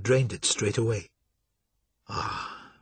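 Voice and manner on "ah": whispered, barely there; soft sigh. Acted. York after draining his goblet, whispered ah. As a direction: breathe it out after the drink. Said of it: "he said softly".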